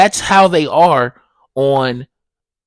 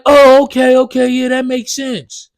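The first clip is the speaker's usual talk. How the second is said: imitating the neighbor; 8 semitones higher, 4 dB louder than usual. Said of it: "It's like".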